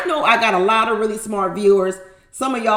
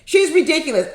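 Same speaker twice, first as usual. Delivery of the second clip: usual speaking voice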